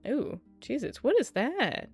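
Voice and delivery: usual speaking voice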